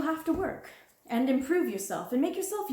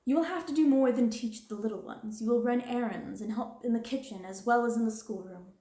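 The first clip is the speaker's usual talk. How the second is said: louder, stern warning; harsh dismissal; 2 semitones higher, 4 dB louder than usual. Acted. Stern threat. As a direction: headmistress threatening the girl and sending her off, harsh.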